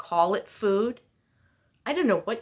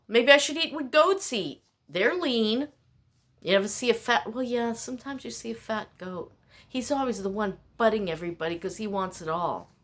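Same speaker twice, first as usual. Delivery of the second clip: normal talking voice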